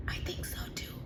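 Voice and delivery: scared, whispered and trembling; quivery voice. Acted. Frightened thief answering his partner, quivery whisper. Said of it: "said Max in a very quivery voice".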